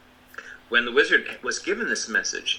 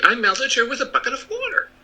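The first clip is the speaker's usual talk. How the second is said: put-on little girl voice; 12 semitones higher, 3 dB louder than usual. A narrator's reading. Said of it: "she answered".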